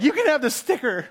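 loud, high retold protest; petulant kid outburst, played for laughs; mock indignant child. Daughter handing mom the unwanted sticker, mock indignation.

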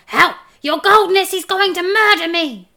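high, loud terrified plea; shrieking. A narrator's reading. Dwarf crying out that he'll be murdered, shrieking. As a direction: shriek for help, pitch way up. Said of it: "shrieked the dwarf".

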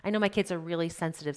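ordinary talk

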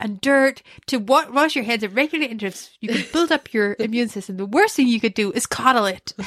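put-on emphatic American accent; American accent, Dwight. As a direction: declare it firmly in an American accent. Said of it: "I put an American accent on".

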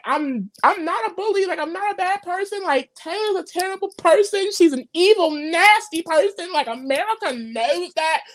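mock crying. Host imitating a housemate venting to Daniel, mock crying. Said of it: "she's crying because she's like".

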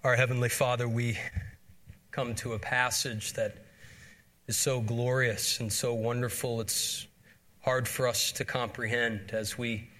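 pulpit prayer. Acted. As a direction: lead the church in prayer, earnest and reverent. Pastor leads congregation in prayer.

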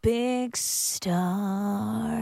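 slow, drawn-out radio ident delivery; slow hammed-up station jingle; mock jingle voice. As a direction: croon it like a cheesy station sting.

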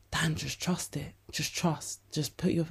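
calm yourself like a quiet inner voice; soft inner voice. Retold or imitated. Her calmer self soothes her doubts, in a soft hush. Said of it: "the other side of my brain is like".

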